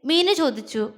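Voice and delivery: plain reading voice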